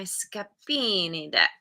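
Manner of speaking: hammed-up, drawn-out Italian accent; terrible Italian accent. Tarot reader naming the Scapini deck, in a terrible Italian accent. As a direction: put on a bad Italian accent, stretching the vowels. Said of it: "Say it with my terrible Italian accent".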